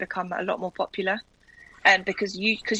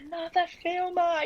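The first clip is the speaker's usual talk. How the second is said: higher, mock-weary groan of a fed-up husband; 9 semitones higher than usual. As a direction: imitate the husband sick of film crews. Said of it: "he was so fed up".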